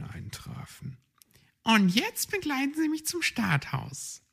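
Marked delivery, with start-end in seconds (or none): 1.6-4.2 high, polite hedgehog lady; old hedgehog lady voice. Acted. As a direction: ask in a high little-lady voice. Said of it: "bat Frau Igel".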